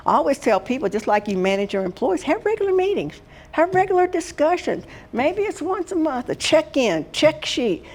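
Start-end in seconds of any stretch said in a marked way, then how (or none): 2.2-4.8 regular said much higher, each time falling after; stress on regular. Shown as a demonstration. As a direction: lift the word regular high, then let it drop. Advising managers on regular meetings.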